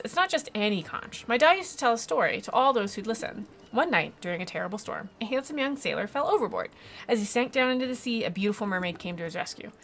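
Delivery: lightly voiced child telling a legend; storytelling child. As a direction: tell it with a child's earnest drama. Child retells father's tale.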